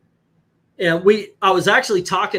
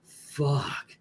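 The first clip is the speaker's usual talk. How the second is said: quiet inner curse; 8 dB quieter than usual. Retold or imitated. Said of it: "but I'm like".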